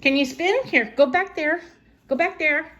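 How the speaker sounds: high, coaxing grandma voice to a little one; sing-song encouragement, pitched well up. Real, not acted. Grandma coaxing her granddaughter to spin, high baby talk.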